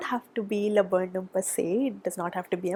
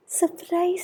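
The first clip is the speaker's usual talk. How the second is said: sung-out high surprise; playful surprise; 8 semitones higher than usual. Real, not acted. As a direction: announce it like a little treat. Playful reveal of the light.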